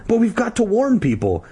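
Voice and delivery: high, urgent insistence; acted pleading insistence; insistent. A narrator's reading. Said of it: "Ashley insisted".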